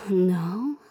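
ordinary talk

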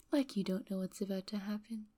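ordinary talk, nothing marked

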